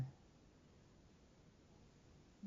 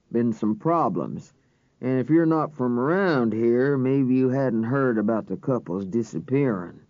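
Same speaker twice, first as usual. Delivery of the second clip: a stern small-town deputy warning them off; drawling deputy; 2 semitones lower than usual. A narrator's reading. Deputy voiced in narration.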